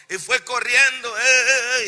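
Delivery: high, loud panicked running cries; excited yelling. Acted. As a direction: yell it as you run in panic. Moses fleeing from snake.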